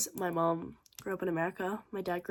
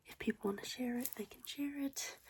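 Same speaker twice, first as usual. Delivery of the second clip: soft unvoiced whisper; quiet whispered afterthought; whispered aside; 5 semitones higher, 9 dB quieter than usual. Real, not acted. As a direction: whisper it like a little secret.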